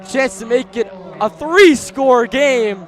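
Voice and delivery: play-by-play caller still fired up; excited call. Real, not acted. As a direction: keep the hype going. Still excited after big play.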